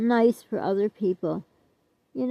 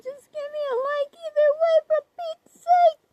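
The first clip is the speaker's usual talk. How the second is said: high, whining plea, slowing at the end; whiny begging. Acted. Vlogger begging viewers to like the video, high whine. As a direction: beg like a whining child with a crying edge.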